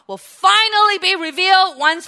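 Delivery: loud, rising preacher's climax; ringing pulpit declaration; triumphant proclamation. Real, not acted. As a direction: build to a jubilant shout. Sermon climax.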